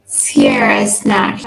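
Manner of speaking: ordinary delivery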